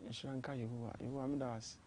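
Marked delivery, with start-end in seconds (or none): 0.1-0.4 murmured thanks to God. Real, not acted.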